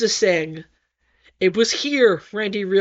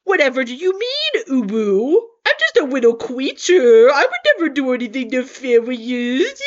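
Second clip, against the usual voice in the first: feigned innocence. Acted. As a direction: play innocent, sugary. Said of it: "feigning innocence".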